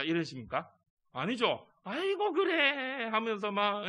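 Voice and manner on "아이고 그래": high, slow, cooing; doting grandparent. Acted. Grandparent greeting grandchild, high, slow and cooing. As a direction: melt over the grandchild.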